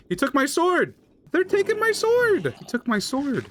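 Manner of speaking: high, loud outraged shriek at the theft; shocked yelling. Real, not acted. Gamer shrieking at the mob that stole his sword. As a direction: yell it like your gear just got stolen.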